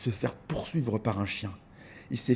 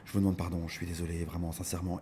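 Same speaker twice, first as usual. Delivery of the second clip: apologetic; 6 semitones lower, 8 dB quieter, faster than usual. Retold or imitated. Apologizing to people.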